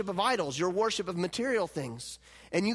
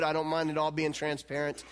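ordinary delivery

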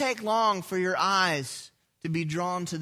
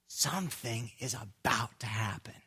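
hushed anticipation; 11 semitones lower, 9 dB quieter than usual. Acted. Crowd expression voiced, hushed.

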